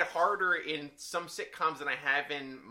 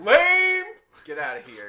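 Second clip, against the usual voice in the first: loud, high jeering call; drawn-out teasing boo; mock heckle; 3 semitones higher, 11 dB louder, slower than usual. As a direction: shout it down in playful derision. Heckles co-host for liking show.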